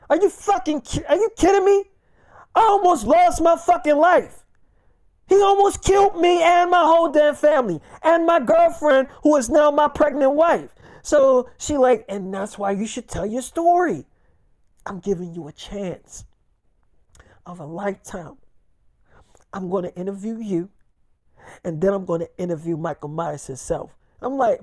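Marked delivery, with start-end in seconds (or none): 0.1-10.7 higher-pitched, incredulous protest; indignant outburst. Retold or imitated. Man refusing interviewer's request after almost losing his family, indignant. 12.1-23.9 low, soft, deliberate pitch; hushed coaxing. Retold or imitated. Podcaster offering him the interview of a lifetime, hushed persuasion.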